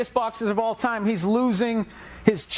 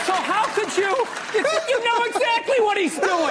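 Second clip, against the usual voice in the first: mock disbelief; 9 semitones higher than usual. Mimics Oprah interviewing with feigned disbelief.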